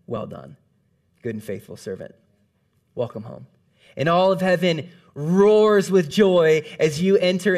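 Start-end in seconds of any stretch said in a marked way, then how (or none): 0.1-3.5 low, soft and solemn, each phrase set apart; a gentle, weighty welcome from above; voice of God. A narrator's reading. God welcoming the believer into heaven, low and solemn. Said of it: "God's voice shouts".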